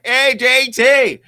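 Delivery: slow, loud high hail; calling back. Retold or imitated. Answering JT across the golf hole, loud slow call. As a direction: stretch it out, shouting back across the course.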